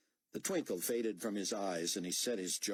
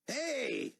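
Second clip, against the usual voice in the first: sharp high cry of protest; shout; 4 dB louder than usual. Acted. Peacekeeper shouting at Buck for cutting in line. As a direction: call out at someone cutting the line.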